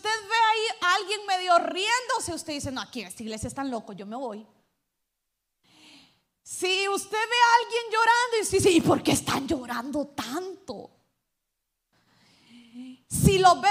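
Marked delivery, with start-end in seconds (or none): 2.7-4.4 quick, low mock complaint from a spooked visitor; scandalized churchgoer. Preacher mimicking a visitor who thinks the church is crazy, hurried mock huff. Pastor voices a startled churchgoer. 8.7-10.9 louder mock bewilderment at the weeping; a hammed-up puzzled outsider asking why; baffled visitor. Pastor imitating a newcomer confused by crying, mock bewildered.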